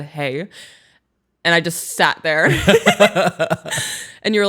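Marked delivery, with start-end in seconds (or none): 0.0-0.5 a low, quiet, mumbled "Hey."; imitating husband's bored phone hello; flat, dull greeting. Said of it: "you just said".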